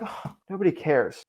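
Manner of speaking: "Oh, nobody cares." said soft and falling, defeated; gloomy resigned take; deflated. Shown as a demonstration. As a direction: let "nobody cares" sink like bad news. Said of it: "It's the same sentence".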